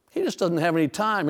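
ordinary delivery

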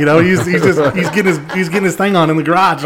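high, loud comic exclamation; loud, animated storytelling. Retold or imitated. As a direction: belt it out, amused and incredulous. Animatedly telling about absent Jerry.

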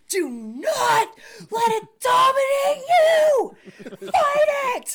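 high, drawn-out hammed-up shout; mock yelling. Housemate answering a cough at home, mock yelling. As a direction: yell it like an over-the-top battle cry. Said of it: "the other one yells".

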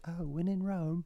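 very high put-on British voice; mock British accent. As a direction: parody an affected English accent, high. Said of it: "And then she's in England".

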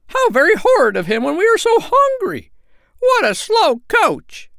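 very high, loud indignant squeals; silly little birds in a shrieky fuss; silly crying out. A narrator's reading. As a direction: squeak the complaint, stretching key words. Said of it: "cried the silly sandpipers".

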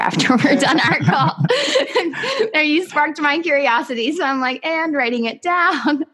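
real giggles breaking into high-pitched talk; laughing. Real, not acted. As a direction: talk through an excited laugh. Host laughs at her own eagerness.

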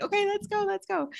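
high squeal of eagerness; excited go-time cheer. Retold or imitated. Two friends finally ready to record, excited high squeal. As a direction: pitch it way up, itching to start. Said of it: "We're like".

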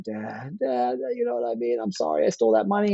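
high, tearful mock sobbing plea to Dad; imitated crying. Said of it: "I'm all crying".

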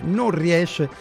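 ordinary delivery

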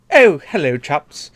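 high posh British announcer; hammy British ad read; British accent. Pretend. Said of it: "what is a British monster truck ad like".

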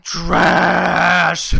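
loud disgusted shout. Real, not acted. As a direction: bark the word out. Repeats verdict on Blair Witch.

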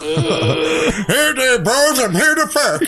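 very high, slow cartoon-kid squeal; weird Cartman voice. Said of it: "like, the weird Cartman voice".

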